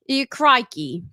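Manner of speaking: a hint of an Aussie accent; Australian accent. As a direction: throw in a light Australian crikey. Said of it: "trying to go for Australian".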